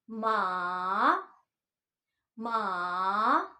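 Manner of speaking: मा dipping down then rising; third tone. Shown as a demonstration. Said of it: "इसको ऐसे pronounce करते हैं". (मा पहले नीचे फिर ऊपर)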